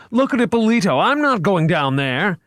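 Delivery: high frantic cry, slowing at the end; terrified, dig in and refuse; panicked refusal. A narrator's reading.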